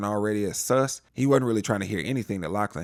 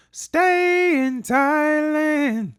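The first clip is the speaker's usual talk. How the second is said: high, loud stretched-out Thailand; dismayed mock wail; 7 dB louder, much slower than usual. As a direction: whine the word Thailand in dread. Mocking reluctance to stay.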